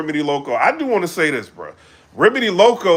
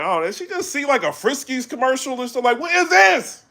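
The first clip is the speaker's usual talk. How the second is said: mocking disbelief; 8 semitones higher than usual. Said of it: "I'm like".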